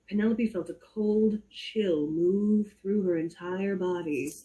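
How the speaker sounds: ordinary delivery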